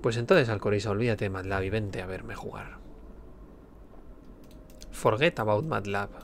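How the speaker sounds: ordinary talk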